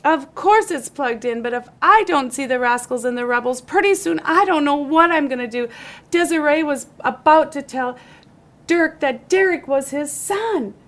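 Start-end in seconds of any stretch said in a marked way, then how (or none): none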